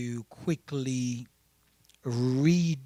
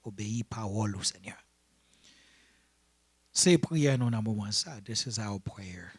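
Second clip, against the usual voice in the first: prayer; 4 semitones lower than usual. Real, not acted. Leads a bilingual prayer.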